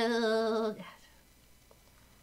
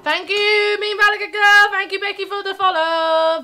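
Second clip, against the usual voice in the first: much higher, louder sing-song thanks; excited thanks; 10 semitones higher, 12 dB louder than usual. Real, not acted. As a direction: gush thanks to the follower. Streamer thanking a follower.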